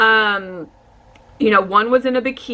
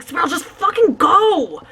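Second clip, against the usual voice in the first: panicked urging; 7 semitones higher than usual. Retold or imitated. Urging boyfriend to drive.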